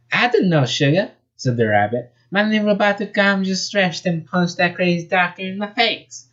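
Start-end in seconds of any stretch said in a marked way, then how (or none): 0.1-1.1 high-pitched southern belle voice; thick southern accent. Acted. Rabbit answers the hedgehog, southern accent. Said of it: "Her voice was a thick southern accent". 2.3-6.3 high-pitched southern belle voice; thick southern accent. Acted. Rabbit answers the hedgehog, southern accent. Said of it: "Her voice was a thick southern accent".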